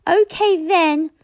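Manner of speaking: high, loud, slow dragon voice; grumpy dragon. A narrator's reading. Toothless agreeing to hunt, high slow grumble. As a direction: grudgingly agree like a small dragon. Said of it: "said Toothless".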